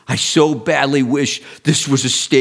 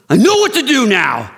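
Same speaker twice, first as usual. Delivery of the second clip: much higher and louder, fired-up burst; a man leaving the stadium, much higher and louder; jacked up; 11 semitones higher, 5 dB louder than usual. Retold or imitated. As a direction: blurt it out, pumped.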